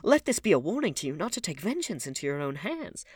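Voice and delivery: high, loud stern lecture; lofty reprimand; stern warning. A narrator's reading. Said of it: "looking at him sternly".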